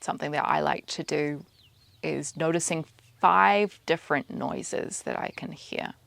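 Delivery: soft, even meditation voice, spaced out with pauses; quiet, unhurried mindfulness cueing; guided meditation. Acted. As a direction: guide their attention gently through the senses. Leads a meditation.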